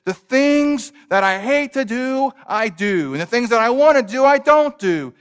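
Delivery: Paul confessing he fails, voiced with rising force; Paul's cry. Preacher voicing Paul's anguish, loud and rising. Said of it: "He says".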